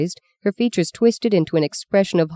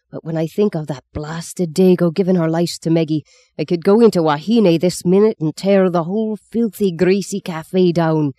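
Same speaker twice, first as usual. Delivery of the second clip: exploding in rage. A narrator's reading. Father raging at the Dago over his daughter's lice, furious outburst. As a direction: let the anger boil over. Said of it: "he exploded".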